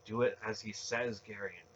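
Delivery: ordinary delivery, nothing marked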